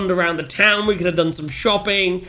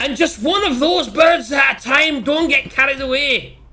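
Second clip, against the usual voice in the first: high, drawn-out shouting; =high dwarf holler; in-character shout; 8 semitones higher, 4 dB louder than usual. Acted. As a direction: shout a slow warning to a companion. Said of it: "I'm like".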